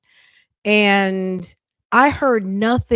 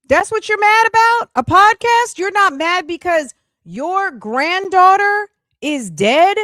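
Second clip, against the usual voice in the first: outraged incredulity; 9 semitones higher than usual. Real, not acted. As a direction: rub her nose in the absurdity. Outraged at her priorities.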